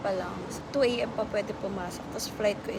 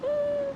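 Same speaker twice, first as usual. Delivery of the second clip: sharp yelp; 4 dB louder than usual. Real, not acted. Startled while eating.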